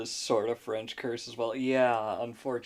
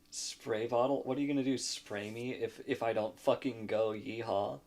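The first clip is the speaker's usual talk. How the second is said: ordinary talk, nothing marked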